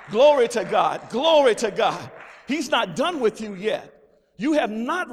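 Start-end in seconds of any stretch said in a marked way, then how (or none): 0.1-2.1 loud preaching exclamation; full-voiced church praise; shouted praise. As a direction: cry out glory to God.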